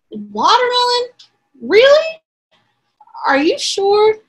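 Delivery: high, loud disbelief; surprised. Acted. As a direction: sound amazed and doubtful. Anteater surprised.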